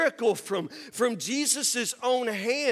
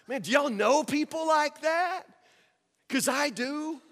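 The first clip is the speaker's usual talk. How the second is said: ordinary delivery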